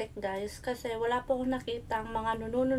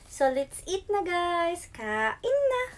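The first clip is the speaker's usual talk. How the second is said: excited call; 6 semitones higher, slower than usual. Real, not acted. Calling everyone to eat.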